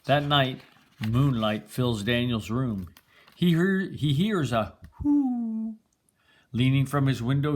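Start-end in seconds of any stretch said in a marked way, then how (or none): none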